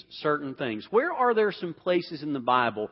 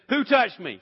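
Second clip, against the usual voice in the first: raised, higher call into the crowd; 6 semitones higher, 8 dB louder than usual. Acted. Jesus calls out to the crowd, a raised demanding call. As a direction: demand it out loud, searching the crowd. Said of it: "He calls her out".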